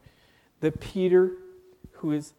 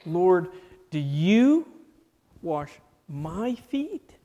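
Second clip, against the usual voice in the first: "you" and "my" hit louder, "feet?" drawn out slowly; stress on you and my; 2 semitones higher, 4 dB louder, slower than usual. Shown as a demonstration. Peter to Jesus, emphatic "you" and "my". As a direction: lean hard on "you" and "my", stretch "feet?". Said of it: "personal pronouns here are in an emphatic position".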